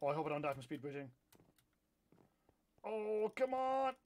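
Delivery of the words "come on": usual speaking voice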